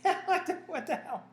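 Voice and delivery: high, laughing shrug of a reply. Retold or imitated. Joking with the interviewer, laughing off the question. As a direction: laugh it off, playful and easy.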